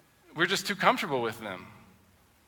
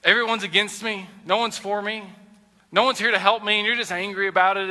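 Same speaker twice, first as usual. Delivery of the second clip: mock self-pity; 5 semitones higher, 4 dB louder than usual.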